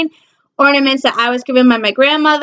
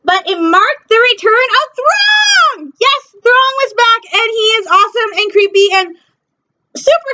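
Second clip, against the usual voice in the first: excited shout; 8 semitones higher than usual. Real, not acted. Thrilled that Thrawn returns.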